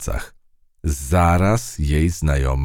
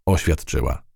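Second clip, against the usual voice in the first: usual speaking voice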